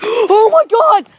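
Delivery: high, loud, drawn-out shriek; terrified scream. Real, not acted. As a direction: scream it in sheer fright. Gasps, sees something in game.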